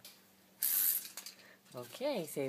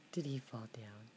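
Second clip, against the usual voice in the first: whisper; 6 semitones lower, 7 dB quieter than usual. Real, not acted. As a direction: breathe the question softly. Whispering to toddler at play.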